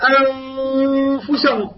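أنفسهم with a held nasal before the fa; recited example. Shown as a demonstration. Teacher demonstrating the hidden nun. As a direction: sing it out a little. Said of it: "يعني أنا أقول".

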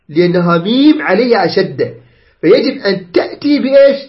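ordinary talk, nothing marked

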